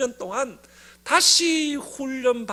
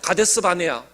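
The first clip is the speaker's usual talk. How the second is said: repeated back louder, falling; loud congregation echo; 6 semitones lower, 5 dB louder than usual. Shown as a demonstration. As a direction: call it back to the room, loud. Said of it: "따라합니다". (크게 따라 외치는 가데스 바네아)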